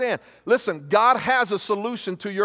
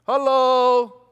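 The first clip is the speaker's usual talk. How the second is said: pointed hello; 3 semitones higher, 4 dB louder than usual. Real, not acted. Preacher to congregation who won't hear the truth, pointed louder hello. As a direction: call out "Hello?" to wake the room up. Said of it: "Let me just say that again".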